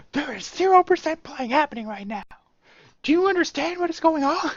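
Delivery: high, hammed-up parody of the warning; mock guard. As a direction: relish the one-liner, camped way up. Repeating the line for fun.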